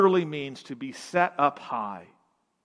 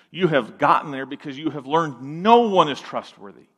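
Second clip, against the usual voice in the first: ordinary delivery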